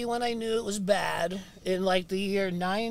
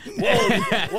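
usual speaking voice

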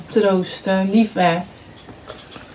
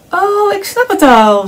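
higher and louder, hurrying on; impatient; 10 semitones higher, 6 dB louder than usual. A narrator's reading. Frog interrupting Hare's lesson, impatient and loud. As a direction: cut Hare off, eager to go. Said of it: "zei Kikker ongeduldig".

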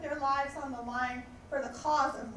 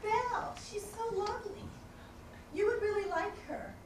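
higher, sweet coaxing tone; mimicking bookstore staff; 7 semitones higher, 3 dB quieter than usual. Bookstore staff gushing to Bell about the author, higher and sweet. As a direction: gently coax her to like the book.

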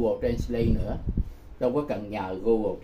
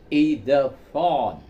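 slow, careful echo of the model phrase; slow imitation of the app's English reading; slow repeat after the app; 4 dB louder, slower than usual. Shown as a demonstration. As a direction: copy the recorded voice slowly for learners. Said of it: "Thì nó".

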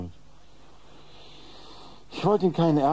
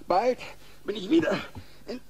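boastful high voice; 10 semitones higher, 4 dB quieter than usual. Acted. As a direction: crow about it. Potter boasting.

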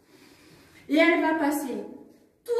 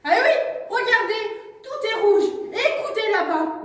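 high, loud accusing; indignant; 7 semitones higher, 3 dB louder than usual. Acted. Cali shows Jules the angry town. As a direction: point it out angrily.

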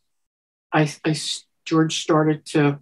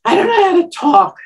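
high, loud and flustered; high flustered panic; 13 semitones higher, 7 dB louder than usual. Retold or imitated. Woman recalling freezing in front of George, high and flustered. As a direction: blurt it like words fail you. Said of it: "I'm like".

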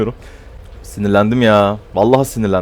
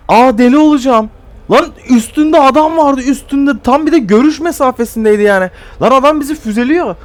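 much higher and louder, outraged ranting; furious rant; 14 semitones higher, 4 dB louder than usual. Real, not acted. Ranting about a blind teammate in the game, furious shout. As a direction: rant at the teammate who can't see the enemy.

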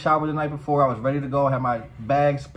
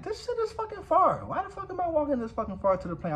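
high-pitched, softer complaint; grumbling to himself; 13 semitones higher, 4 dB quieter than usual. Retold or imitated. Walking to a distant gate, high self-directed grumble. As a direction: whine it under your breath, pitched way up. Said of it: "I did say to myself".

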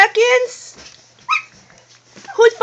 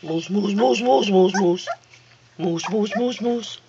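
much lower, softer repeated name; low sing-song calling; 9 semitones lower, 5 dB quieter than usual. Real, not acted. Owner crooning her pet's name, low and soft.

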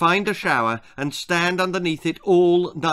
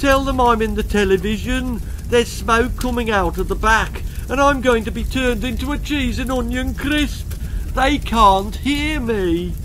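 pleading; 7 semitones higher, 4 dB louder than usual. Acted. Continues pleading.

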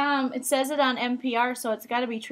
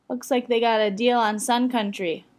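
ordinary delivery

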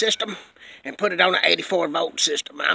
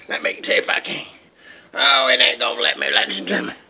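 ordinary talk

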